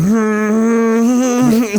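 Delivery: long, high strained hum; imitated pained groan. Gripping the armrest with tooth pain, imitated pained groan. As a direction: hold a rising whine of mock agony. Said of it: "gripping my armrest and going".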